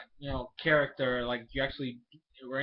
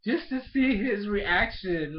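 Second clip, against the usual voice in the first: ordinary delivery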